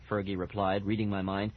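plain reading voice

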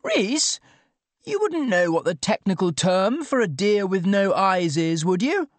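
high, keen calling-out; high-pitched, over-keen enquiry; eager enthusiasm. A narrator's reading. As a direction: ask a colleague eagerly in a high voice. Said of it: "with fatal enthusiasm".

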